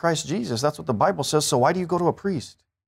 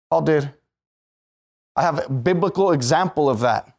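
ordinary talk

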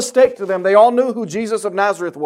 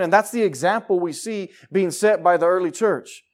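ordinary talk, nothing marked

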